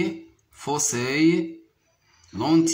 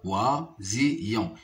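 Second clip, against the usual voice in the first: slow "Oisillon", three parts with gaps; syllable by syllable; 3 semitones lower, slower than usual. Shown as a demonstration. Teacher counting syllables for pupils, "Oisillon" split in three. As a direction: separate oi, si and yon in "Oisillon". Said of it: "se compose de trois syllabes".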